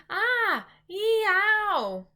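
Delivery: high, loud giant howl of pain; high giant wail. A narrator's reading. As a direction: let the nightmare rip out of him. Giant howling in nightmare.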